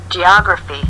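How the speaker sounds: ordinary talk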